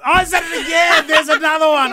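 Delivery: high startled cry at her own slip; shocked cry. Real, not acted. Host catching himself saying baby again, with a startled cry. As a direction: yelp it out, caught breaking the rule again. Caught saying the banned word.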